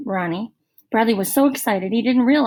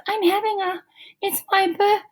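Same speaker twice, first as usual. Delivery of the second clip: put-on nervous girl; 10 semitones higher than usual. A narrator's reading. Colleen trying to invite Jeff.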